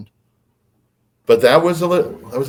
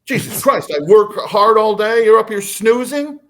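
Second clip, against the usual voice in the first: loud, high retold rant; imitated husband's indignant yelling; angry dad; 11 semitones higher, 5 dB louder than usual. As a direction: berate a spouse in outrage. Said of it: "And he's angry".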